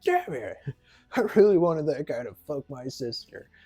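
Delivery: imitated dejected bro; mock sad bro. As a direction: lament it like a sore loser. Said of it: "Bro's, like, all sad".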